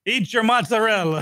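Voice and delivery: laughing put-on Italian mama; playful Italian mother voice, high; Italian mama accent. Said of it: "the fake Italian mama accent".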